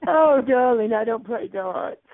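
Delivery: high, sing-song camp "oh darling"; camp diva voice. Pretend. Teasing the caller in a high camp diva voice. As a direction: swoon it out like a vain diva. Mocking caller, sudden put-on voice.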